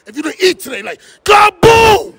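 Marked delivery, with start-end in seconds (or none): 1.2-2.1 high, loud furious kaboom; mad shout. Shown as a demonstration. Host shows fan a mad kaboom, loud shout. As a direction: shout it like you haven't eaten. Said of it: "I need you to be mad".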